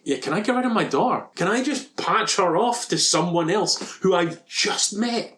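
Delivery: a lightly voiced sarcastic Brad Pitt; mock Brad Pitt. Teasing Brad Pitt's character about dumping his daughter, mock pleading.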